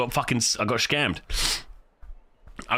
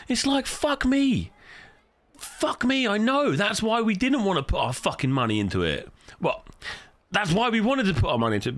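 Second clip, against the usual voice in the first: exasperated outburst, much higher pitch; heated rant; 8 semitones higher than usual. Real, not acted. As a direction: vent your frustration at him. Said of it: "sorry, I'm getting a bit heated".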